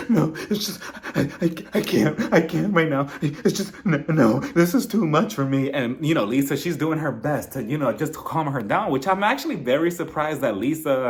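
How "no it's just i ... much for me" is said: mock tearful Monica.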